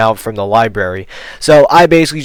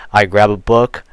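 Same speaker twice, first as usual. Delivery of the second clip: ordinary talk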